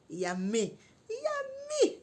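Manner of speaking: drawn-out, very high squeal; delighted squeal. Retold or imitated. Storyteller swooning over her handsome date, delighted squeal. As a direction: squeal it with relish.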